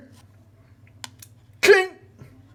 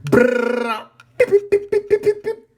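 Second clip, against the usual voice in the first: imitated gunfire; 6 dB louder than usual. Real, not acted. Imitating game sounds.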